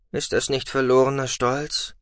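plain reading voice

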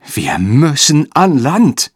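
eindringlich, langsam und höher; beschwörend. A narrator's reading. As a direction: den Kapitän flehend beschwören. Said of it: "beschwor der Steuermann". (eindringlich beschwörend)

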